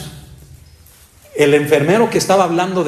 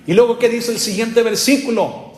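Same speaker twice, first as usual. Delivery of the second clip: raised, high, slow preacher's delivery; preaching; 6 semitones higher, slower than usual. Acted. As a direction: preach it out to the congregation. Preaching at full force.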